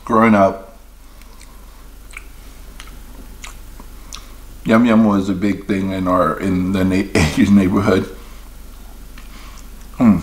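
chewing while talking; talking between bites; mouth full. Real, not acted. Seen 4: Donut held at mouth, bites and chews throughout the stretch.

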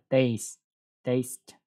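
"taste" with a clear EI diphthong; model pronunciation. Shown as a demonstration. Teacher drills students on the vowel, careful. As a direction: repeat "taste" slowly as TAYST. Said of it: "Cái chữ A này nó phiên âm là E I".

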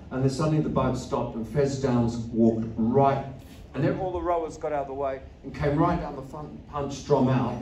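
normal talking voice

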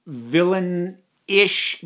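ordinary talk, nothing marked

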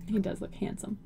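soft unvoiced aside; whispering. Real, not acted. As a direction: whisper it like a secret. Whispered compliment to character.

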